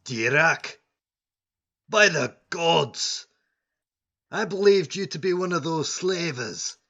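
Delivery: loud, high cry of relief; excited shout of recognition. Acted. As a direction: call out as if spotting a lost friend. Dwarf guard recognizes old friend.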